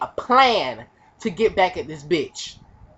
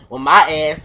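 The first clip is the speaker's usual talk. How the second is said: ordinary delivery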